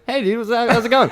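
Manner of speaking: high, easygoing mock hello; casual greeting. Roommate greeting a weird stranger at a party, totally casual. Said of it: "like, totally casual about it".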